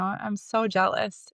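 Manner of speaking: slow, drawn-out "I'm so jealous" with each word stretched; slow emphasis. Retold or imitated. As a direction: lean into "so jealous", slowly. Repeated, slowed.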